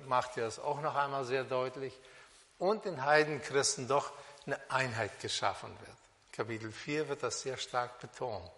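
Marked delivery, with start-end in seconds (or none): none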